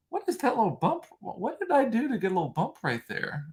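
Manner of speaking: high-pitched mock wonder; playful mock puzzlement. Spotting a little bump on herself, playfully puzzled. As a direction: puzzle over it in a high, silly voice. Mock surprise at own muscle.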